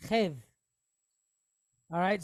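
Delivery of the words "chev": Chev said with a throaty ch and short e; Hebrew syllable demo. Shown as a demonstration. Teacher sounds out Chaf with Segol, careful Hebrew pronunciation. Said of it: "with a Veit behind it".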